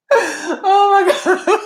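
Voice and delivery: high laugh into a slow laughing oh my God. Real, not acted. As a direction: crack up and exclaim slowly. Laughing at own story.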